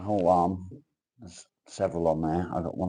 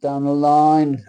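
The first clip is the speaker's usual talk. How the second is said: high, loud and very slow; dramatic proclamation; 8 semitones higher, 10 dB louder, much slower than usual. Acted. Reading a card to the stream, booming slow proclamation. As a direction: belt it like a movie trailer voice.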